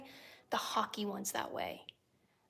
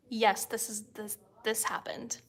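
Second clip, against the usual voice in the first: ordinary talk, nothing marked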